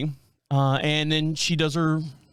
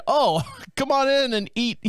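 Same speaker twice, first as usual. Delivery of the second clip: higher inviting ad voice, half laughing; mock ad pitch; 8 semitones higher than usual. Restaurant ad inviting diners, higher teasing pitch. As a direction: lure them in like a commercial.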